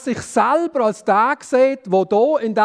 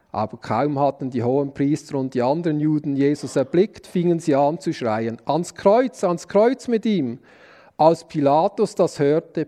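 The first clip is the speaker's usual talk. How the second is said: measured, lower reading voice for the Passion text; scripture reading; 7 semitones lower than usual. Acted. Preacher reading the trial before Pilate to the congregation, solemn scripture reading. Reads the Passion account aloud.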